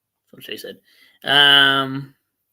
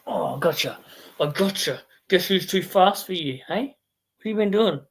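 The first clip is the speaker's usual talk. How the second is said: higher, louder teasing voice to the cat; playful pet voice; 7 semitones higher, 4 dB louder than usual. Real, not acted. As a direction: tease the cat like a playful chaser. Playing rough with the cat.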